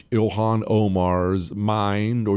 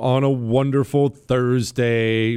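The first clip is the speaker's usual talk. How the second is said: radio host intro; 3 semitones higher, 3 dB louder, slower than usual. Acted. Show opening announcement.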